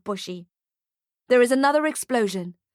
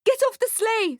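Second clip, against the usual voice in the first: high-pitched panicked scream; urgent, frightened high cry; 13 semitones higher than usual. A narrator's reading. Mother orders son off burning sleigh, screaming. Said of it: "screams the woman in blue".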